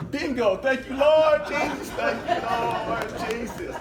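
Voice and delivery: loud, high burst of thanks to the Lord; joyful praise. Real, not acted. Thanking God for the students' call, loud and high. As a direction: shout it out to heaven, overjoyed.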